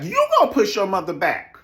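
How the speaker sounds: loud, high, incredulous comeback; loud retort. Real, not acted. Host firing back at the castmate, loud retort. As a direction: raise pitch and volume sharply.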